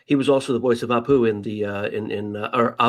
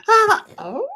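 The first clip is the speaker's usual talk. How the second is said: imitating a child; 5 dB louder than usual. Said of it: "And they go".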